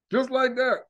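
ordinary talk

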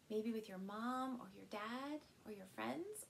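usual speaking voice

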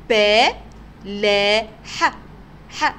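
ب، لا، ح as separate sounds, "ba, laa, ha"; teacher isolating ب، لا، ح with gaps; sounded out. Shown as a demonstration. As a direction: break the word up, repeating a short final ح. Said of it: "يا ترى سمعنا صوت الحاء".

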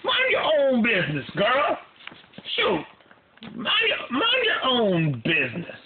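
high sassy snap; high mock-sassy mind your own business; sassy retort. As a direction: tell her off with attitude. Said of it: "I was like".

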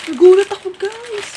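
sudden loud, high startled cry. Real, not acted. Vlogger jolted by the spring prank, startled. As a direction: yelp it out, pitch leaping up.

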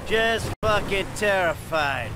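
low, dry mock fear; sarcastic. Robber sneering back at the gang boss's wife, sarcastic. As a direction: sneer that he's scared.

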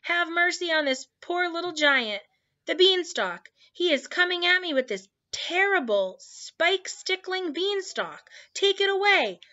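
high, panicked begging; frantic high-pitched plea; screamed pleading. A narrator's reading. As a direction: wail for mercy like a terrified giant. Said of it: "screamed the flesh lump eater".

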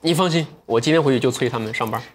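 normal talking voice

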